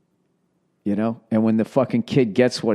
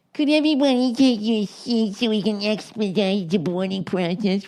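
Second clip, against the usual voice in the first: high-pitched mock cabin PA voice; flight attendant announcement; 11 semitones higher than usual. Flight attendant to boarding passengers, imitated announcement voice.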